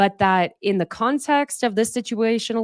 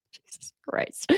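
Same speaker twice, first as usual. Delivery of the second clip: breathy whisper; 8 dB quieter, slower than usual. Real, not acted. Exasperated aside after sneeze.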